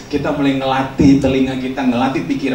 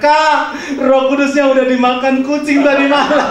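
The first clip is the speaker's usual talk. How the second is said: put-on high, loud child's cry, laughing through it; child shouting; 11 semitones higher, 4 dB louder than usual. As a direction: shout down from the loft like a scared kid. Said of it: "anak ini teriak".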